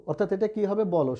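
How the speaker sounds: ordinary talk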